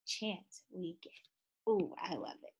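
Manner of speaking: ordinary talk, nothing marked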